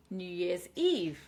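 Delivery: "New Year's Eve" slow, landing on Eve; last-syllable stress. Shown as a demonstration. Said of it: "Stress on the last syllable".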